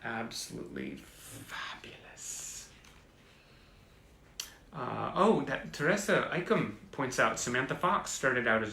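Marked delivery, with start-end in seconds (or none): 0.0-2.7 whisper. Real, not acted. Awed by a poster.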